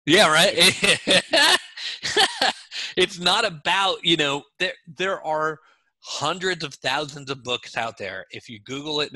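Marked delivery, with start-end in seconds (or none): 0.6-2.9 a real burst of high laughter; loud high laugh. Real, not acted. Laughing hard at the joke about sales on the book cover. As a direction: laugh out loud, pitch jumping up.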